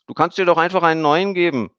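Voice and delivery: put-on high, loud little girl's voice; a bright child chattering on. A narrator's reading. Emmy the girl suggests a new name, high girl's voice.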